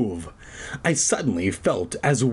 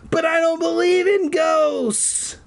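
mock dramatic voice. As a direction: overplay the shocked protest. Mocking voice for italic thought.

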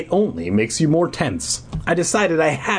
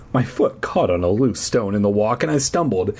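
plain reading voice

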